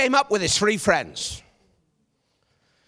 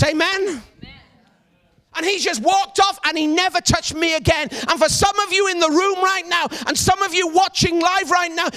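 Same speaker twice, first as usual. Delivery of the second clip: preaching at full force; 5 semitones higher than usual. Acted. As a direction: rouse the room to stand up inside.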